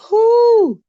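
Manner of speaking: high loud relieved exhale; whew. Real, not acted. To her viewers after scaring herself, high whew. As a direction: blow out the fright.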